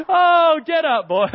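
put-on hoot, then brush the child off; laughing dismissal. Cousin brushes off son's fall.

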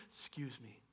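a quiet, polite angel's "Excuse me"; hushed angel clearing its throat; soft angel voice. Pretend. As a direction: interrupt gently like a polite angel.

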